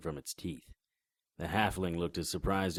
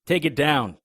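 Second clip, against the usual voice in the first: loud, higher yell; shouted demand; 7 semitones higher, 11 dB louder than usual. Acted. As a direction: demand she drops the barrier. Demanding barrier be dropped.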